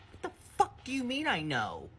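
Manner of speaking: high, stunned, outraged demand; incredulous outburst. Retold or imitated. To her friend on the phone, a high incredulous outburst. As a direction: fire it back at her in disbelief. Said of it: "And I was like, literally".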